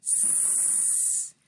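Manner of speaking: "S." as a soft voiceless hiss; isolated s sound. Shown as a demonstration. Teacher showing kindergarteners the s sound, soft hiss. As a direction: hiss out the "S." with no vowel. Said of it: "They all begin with a s sound".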